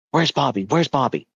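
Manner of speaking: lightly acted frantic little boy, repeating himself; urgent demand. Son rushing downstairs to his parents, urgently demanding.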